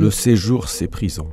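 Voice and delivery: French verse read aloud with care; poem recitation. Acted. Translator quoting the poem's opening line to the host, recited. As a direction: lift the first words, then settle into the line.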